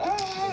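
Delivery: high-pitched mock scream; freaked-out scream. Truck flees gladiators, high cartoon scream. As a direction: squeal high without much volume. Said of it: "And Mater's freaking out".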